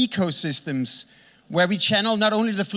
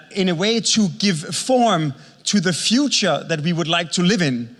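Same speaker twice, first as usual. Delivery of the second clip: ordinary talk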